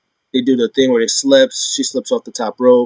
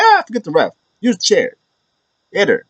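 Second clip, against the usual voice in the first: mock Dom egging on; 9 semitones higher than usual.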